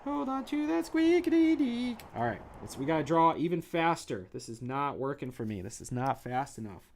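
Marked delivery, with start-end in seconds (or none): 0.0-2.0 high, loud cheering shouts; hyped shouting. Pretend. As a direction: whip the crowd up.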